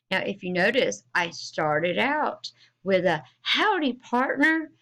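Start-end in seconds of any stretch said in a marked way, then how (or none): 3.4-4.7 high, excited cowboy hello; excited greeting. Shown as a demonstration. Teacher showing how an exclamation mark reads, excited. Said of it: "I needed to read with excitement".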